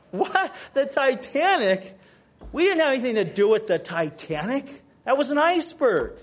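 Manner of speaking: can't believe the accusation; stunned indignant rebuttal; outraged disbelief. Acted.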